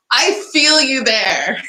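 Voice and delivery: ordinary talk, nothing marked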